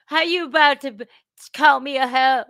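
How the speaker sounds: hammed-up outraged retort; mock indignation. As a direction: imitate an offended podcast guest. Acts out the angry podcast guest.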